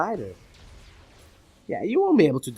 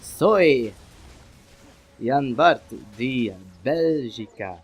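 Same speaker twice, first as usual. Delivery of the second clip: slow, hammed-up fake Italian accent introducing himself; drawn-out fake Italian self-introduction; mock Italian accent; 4 dB louder, much slower than usual. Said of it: "Why am I speaking with bad Italian accent?".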